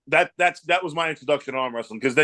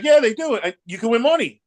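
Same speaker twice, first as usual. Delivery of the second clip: a much higher, louder imitation of his wife cheering him on; excited wife; 9 semitones higher, 4 dB louder than usual. Husband voicing his wife urging him to enter, high and eager. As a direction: play the wife, eager and pushy, pitched up high. Wife's excited reply, voiced in story.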